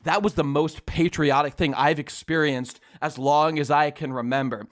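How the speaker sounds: low, solemn man's voice; deep, grave mock sincerity; earnest fellow rider. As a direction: voice an awestruck stranger, dropped well down. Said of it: "He turns to me and he says".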